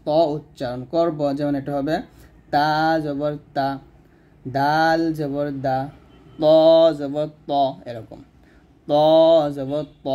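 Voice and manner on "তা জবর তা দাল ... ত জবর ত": slow "তা", "দা", "ত", tongue on the gum ridge behind the upper teeth; slow letter drill. Shown as a demonstration. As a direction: tap each syllable slowly. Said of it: "জিহ্বাটা উপরে দুই দাঁতের গোড়ার সঙ্গে লাগবে". (ধীরে "তা জবর তা, দাল জবর দা")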